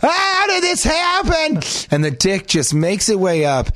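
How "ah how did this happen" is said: panicked scream. Pretend. Man staring at his pelvis, screaming in panicked horror. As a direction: shriek in mock panic at the sight.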